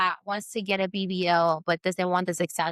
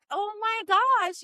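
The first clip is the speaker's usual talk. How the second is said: much higher, slow, drawn-out gasp of disbelief; stunned oh my gosh; 13 semitones higher, slower than usual. Retold or imitated. Recalling her inner reaction to a client, in slow stunned awe. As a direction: stretch it out in wide-eyed shock. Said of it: "I'm like".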